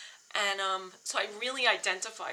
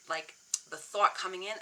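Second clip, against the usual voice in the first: normal talking voice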